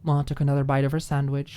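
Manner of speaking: ordinary delivery, nothing marked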